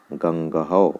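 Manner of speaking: गंगहौ said ganga-hau with a final hau; visarga said as hau. Shown as a demonstration. Teacher reading the example गंगहौ to students as ganga-hau. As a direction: end गंगहौ on a clear hau. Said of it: "औ हो तो हौ जैसा होता है".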